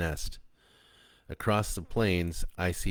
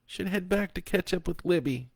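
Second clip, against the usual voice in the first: between sobs; 13 semitones higher than usual. A narrator's reading. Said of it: "Kat said between sobs".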